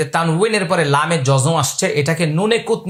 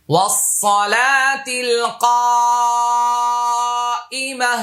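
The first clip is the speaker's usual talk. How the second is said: slow, higher, drawn-out chant; dua recitation; 5 semitones higher, much slower than usual. Shown as a demonstration. Teacher recites the dua to students, slow melodic chant. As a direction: intone the prayer, lengthening every vowel.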